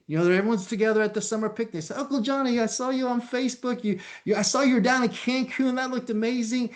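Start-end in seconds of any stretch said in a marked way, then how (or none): 2.0-6.7 high, eager voice of an impressed relative; gushing relative. Retold or imitated. Relative at picnic gushing to Uncle Johnny, high and eager. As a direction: sound wowed by his Cancun photos.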